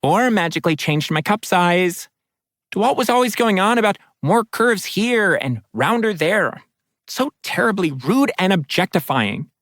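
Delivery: chatty water spirit, pitched high; bright gossiping spirit, up high. Acted. Nerissa gossips on.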